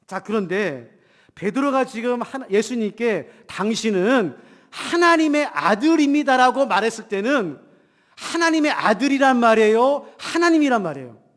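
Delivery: ordinary talk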